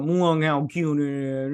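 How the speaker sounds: slow, drawn-out gushing coo; mock cooing. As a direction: coo it as at a cute pet. Cooing over the cute creature.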